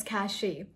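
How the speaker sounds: cachet as "cashee"; reported mispronunciation. Retold or imitated. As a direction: say cachet ending in ee. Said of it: "someone pronouncing this word as".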